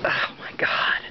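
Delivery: breathy whispered aside. Real, not acted. Owner to herself about the dog, whispered. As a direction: whisper it under your breath.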